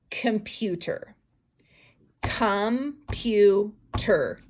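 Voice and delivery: Computer drawn out slowly, each syllable separate; syllables stretched. Shown as a demonstration. Teacher stretching Computer into syllables for the class. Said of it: "Three syllables".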